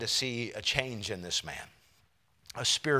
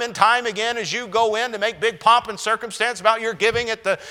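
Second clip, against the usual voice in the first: high, loud scolding tirade; indignant rant; 9 semitones higher, 10 dB louder than usual. Acted. Healed man berating the religious elite, loud indignant rant. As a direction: let him rail at the bigwigs, loud and high.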